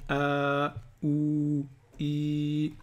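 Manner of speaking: A, OU, I each drawn out on a level tone; long vowels. Shown as a demonstration. Teacher introducing long vowels to learners, vowels held long. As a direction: hold "a", "ou", "i" long and even. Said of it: "on passe aux voyelles longues".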